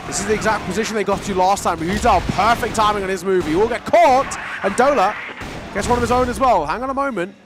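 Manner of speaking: rising hype, loud and high; excited casting. Real, not acted. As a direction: call the fight as it erupts. Caster hypes a big fight.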